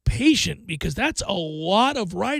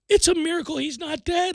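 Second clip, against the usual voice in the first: high-pitched reenacted astonishment; mock amazement; 8 semitones higher than usual. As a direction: marvel at it as a gullible reader. Reenacting shock while reading the book.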